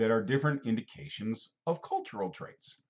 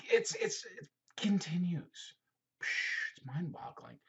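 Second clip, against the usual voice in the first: hushed awe; 5 semitones higher, 7 dB quieter than usual. Acted. Teacher marvelling at huge region.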